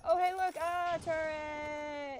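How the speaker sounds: sudden loud alarm at a turret; surprised shout. Real, not acted.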